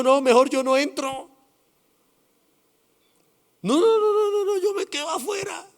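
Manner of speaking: high, rising, frantic no no no; a scared son backing away from the door; panicked refusal. Acted. Son hesitating at father's door.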